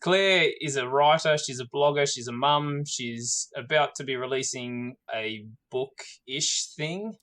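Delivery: ordinary talk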